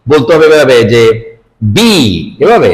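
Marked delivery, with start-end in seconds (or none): 1.8-1.9 "বি" with lips pressed shut before release; lips joined. Shown as a demonstration. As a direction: close both lips then say "বি". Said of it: "লিপসটাকে join করতে হবে".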